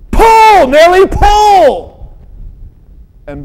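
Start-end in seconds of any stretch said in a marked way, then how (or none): 0.1-2.1 high, loud drawn-out shout; farmer's yell. Acted. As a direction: bellow a command to the horse. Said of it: "the farmer yelled".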